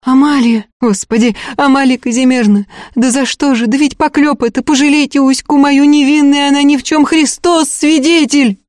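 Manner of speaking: high-pitched lamenting entreaty; pleading high and frantically for mercy; wailing plea. A narrator's reading. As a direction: beg desperately in a peasant wail. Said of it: "Громче всех голосила Агафья".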